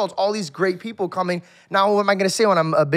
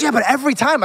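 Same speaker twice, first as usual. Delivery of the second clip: higher, louder mock complaint; irritated friend; 6 semitones higher, 5 dB louder, faster than usual. Friend complaining about constant thanks, mock irritated voice. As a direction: whine it like the annoyed friend, up and loud. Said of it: "He goes".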